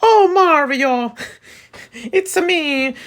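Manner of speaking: very high, stretched-out cartoon plumber; Mario impression. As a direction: squeal it like an Italian game hero. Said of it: "trying to sound like a freaking fictional game character".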